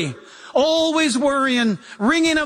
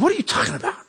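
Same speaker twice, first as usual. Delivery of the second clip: puzzled wife; 9 semitones higher, much faster than usual. Retold or imitated. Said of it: "She says".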